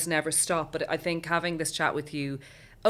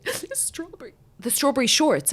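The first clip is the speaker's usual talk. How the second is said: much higher little-girl voice, mimicking; imitating upset daughter; 9 semitones higher, 5 dB louder than usual. Mother mimicking her daughter's meltdown, high child voice. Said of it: "and then she goes".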